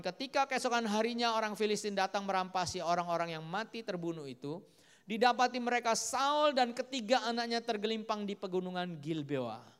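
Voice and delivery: forceful preaching. Acted. Preacher recounts Saul and his sons' fall, forceful preaching. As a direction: declare the grim outcome to the hall.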